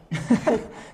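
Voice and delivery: a short high laugh; high burst of laughter. Real, not acted. Host laughing at the co-host's joke, high burst of laughter.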